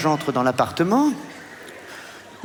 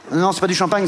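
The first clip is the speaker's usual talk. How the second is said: ordinary delivery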